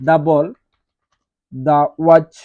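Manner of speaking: "দা ball, দা watch" said loud, with "দা" as a clear "duh"; emphatic classroom drill of "duh ball, duh watch". Shown as a demonstration. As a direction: call out "দা" as "duh" before each noun. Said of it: "এটাকে দা বলতে হবে".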